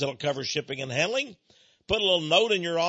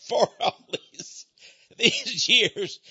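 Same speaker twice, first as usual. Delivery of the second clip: laughing; 6 semitones higher, much slower than usual. Real, not acted. Laughing about his name.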